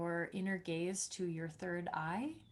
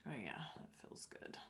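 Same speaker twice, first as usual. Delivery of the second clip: soft, breathy whisper of relief; 9 semitones lower, 15 dB quieter than usual. Real, not acted. Whispered during self-massage.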